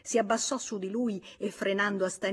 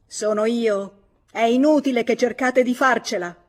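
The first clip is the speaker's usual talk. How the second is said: loud, higher shout of an angry husband; husband shouting at his hiding wife; 4 semitones higher, 10 dB louder than usual. A narrator's reading. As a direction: call her out through the door. Husband confronts wife through door.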